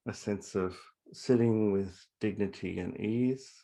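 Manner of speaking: calm meditation guide with long pauses; guided meditation. Acted. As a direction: settle listeners gently into their breathing, letting phrases fall away. Leads a breathing meditation.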